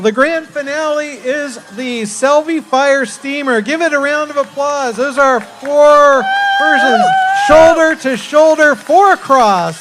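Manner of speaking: big, loud showman presenting the finale; hyped announcer. Acted. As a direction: sell the grand finale to the crowd. Announcer hypes the grand finale.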